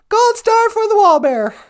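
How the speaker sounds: high puppet shouting praise; cheering puppet. Acted.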